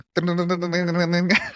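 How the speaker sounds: imitated rubber-band gibberish, pitched high; twanging rubber-band noise. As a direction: mimic speech as a springy high babble. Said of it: "it was like a rubber band".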